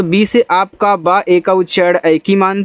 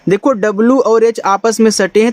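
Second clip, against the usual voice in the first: usual speaking voice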